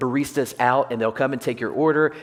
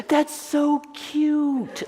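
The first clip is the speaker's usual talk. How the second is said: much higher, very slow gushing coo; gushing wife; much slower than usual. Retold or imitated. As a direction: stretch it out like a delighted wife. Imitating wife Michelle.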